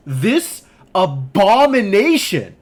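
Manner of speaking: loud, slow, drawn-out reading; dramatic reading. Acted. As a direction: boom it out like a headline quote. Dramatic reading of shard quote.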